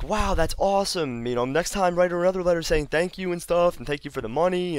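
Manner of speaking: high put-on motherly enthusiasm; mom feigning amazement. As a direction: imitate a mom playing along. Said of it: "like she didn't know".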